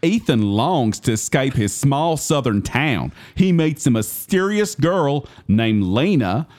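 slow, exaggerated country bumpkin Southern drawl; Alden Ehrenreich's Southern accent. Host reads the movie synopsis as its lead, hammed-up Southern accent. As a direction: read it in a bad, drawn-out Southern accent. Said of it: "read it in the voice that, uh, Alden Ehrenreich did".

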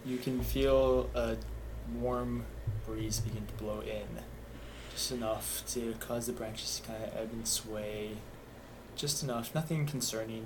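softly spoken game master narration; gentle scene setting. Acted. Game master describing warm breeze to players, softly narrating. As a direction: ease the players into a calm night, unhurried.

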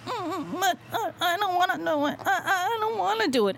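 high, whiny pleading, near tears; a man whimpering to himself that he won't do it; whimpering reluctant voice. Retold or imitated. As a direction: play a scared man begging not to. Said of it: "it was something like this".

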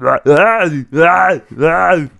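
high, loud strained "ah"; repeated mock Austrian bellows; Arnold-style yells. Podcaster proving a bad impression passes, high loud yells. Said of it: "you can't tell because it's just".

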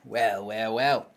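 loud, high cockney copper; hammed-up bobby voice; london policeman. Mock British bobby routine.

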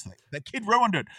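fed-up complaint about a spoiler; exasperated outburst. Real, not acted. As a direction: blurt out the annoyance. Frustrated at kid spoiling plan.

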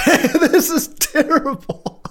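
high, slow, helpless laughing; laughing disbelief. Real, not acted. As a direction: crack up while saying it. Laughing at how bad it is.

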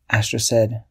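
ordinary delivery

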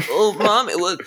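put-on high whiny British kid; whiny child voice. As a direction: whine it like a spoiled child. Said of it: "little whiny ass child".

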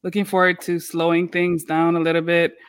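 ordinary talk, nothing marked

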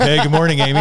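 high, loud easy charming hello; smooth handsome guy. Retold or imitated. Tom Brady greeting Amy, hammed-up smooth. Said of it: "grabs her boob and is like".

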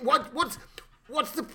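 ordinary talk, nothing marked

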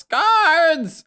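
high, loud drawn-out call; shout. Acted. Old woman calling the guards from her door, high shout. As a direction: shout high for help, stretching the word. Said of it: "She just shouts".

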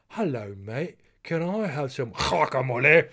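mock diner, hammed-up guttural "guacamole"; overdone Spanish pronunciation. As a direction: burst loudly into exaggerated Spanish on "guacamole". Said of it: "it kinda always sounds weird".

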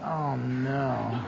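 very soft, low groan of dismay; dismayed hush. Retold or imitated. Seeing mother and daughter crying, soft dismayed oh no. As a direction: sink into an embarrassed hush.

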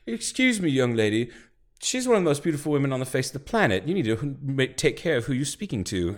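high, brisk British reprimand; indignant British gentleman voice; British scolding. Retold or imitated. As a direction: defend her like an offended English gent. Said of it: "very British".

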